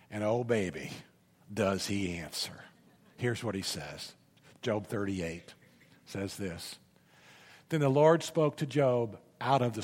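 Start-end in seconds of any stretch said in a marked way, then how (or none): 0.1-6.8 low, soft hush before the big reveal; dramatic hush. Real, not acted. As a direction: drop to a near whisper for suspense. Dramatic hushed build-up.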